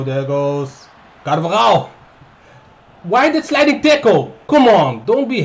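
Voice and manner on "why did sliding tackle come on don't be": high, loud protest; outraged. Real, not acted. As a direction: protest at the foul. Complaining about a tackle.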